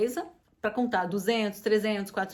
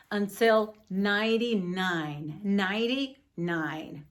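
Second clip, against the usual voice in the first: slow model; much slower than usual. Shown as a demonstration. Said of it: "model count".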